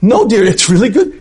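acted husband, high-pitched insistence; heated denial, hammed up; exasperated protest. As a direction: protest in a mock squabble. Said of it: "have a big fight".

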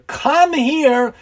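put-on high, beckoning old man's call; friendly calling out. A narrator's reading. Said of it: "in a friendly manner".

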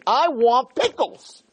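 high, slow put-on child's whine; childish whine. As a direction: beg like a spoiled kid. Mock craving voice.

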